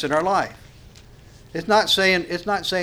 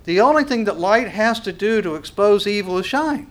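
ordinary delivery

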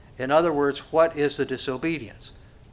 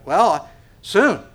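mock evasive; 6 semitones higher, 9 dB louder than usual. Procrastinator's reply.

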